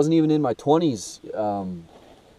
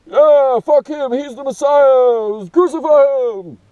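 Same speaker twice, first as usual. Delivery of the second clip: screaming mob voice, much higher and louder; mob shouting; 12 dB louder, slower than usual. Retold or imitated. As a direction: yell it like an angry crowd. Voicing the Pharisees' crowd.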